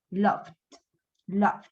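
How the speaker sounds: low one-syllable "Loved"; D-only ending. Shown as a demonstration. Teacher repeats with D not I, silent E. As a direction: end "Loved" on D, never an I sound. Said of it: "D, la D, no la I".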